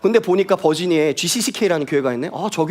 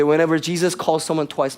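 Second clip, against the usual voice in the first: ordinary talk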